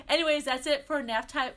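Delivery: high, loud, through leftover giggles; laughing sign-off. Real, not acted. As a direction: sign off while still laughing. Signing off after laughing at slip.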